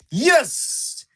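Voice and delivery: high, loud burst of joy; excited yes. Real, not acted. Streamer cheering a new moderator, excited. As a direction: shout it out, thrilled. Celebrating his twenty-first moderator.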